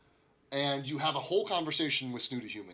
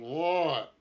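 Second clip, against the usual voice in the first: muffled shout through a door; a muffled what through the wall; 3 semitones higher, 6 dB louder than usual. Acted. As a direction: call it from behind a thick door. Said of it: "you hear just a muffled".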